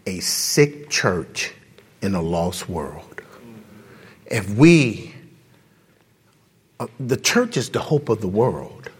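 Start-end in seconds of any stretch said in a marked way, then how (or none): 0.1-3.2 slow, hushed, sinking into a whisper; solemn slow whisper at the end; grave quote. Retold or imitated. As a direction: deliver the quote low and heavy. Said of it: "He said".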